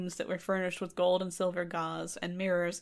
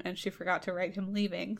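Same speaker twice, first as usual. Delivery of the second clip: normal talking voice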